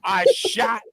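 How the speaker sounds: hammed-up Scottish accent, high mock wail; Luke, Yorkshire accent turned Scottish. Friend carrying on Luke's impression, mock-anguished Scottish accent. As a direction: cry out in a badly faked Yorkshire accent. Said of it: "here's Luke's impression of it".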